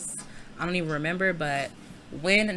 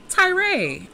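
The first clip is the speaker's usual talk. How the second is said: high, loud scolding shout; exasperated call-out; 11 semitones higher, 5 dB louder than usual. Real, not acted. Player scolding Tyray for making a mess, exasperated.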